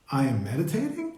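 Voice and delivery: "I am meditating" with the pitch rising on "meditating"; questioning inflection. Shown as a demonstration. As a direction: turn "I am meditating" into an open question. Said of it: "the inflection goes up".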